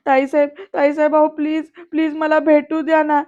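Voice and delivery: urgent, imploring plea; pleading. A narrator's reading. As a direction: beg to be let through. Anandi begs to be let in.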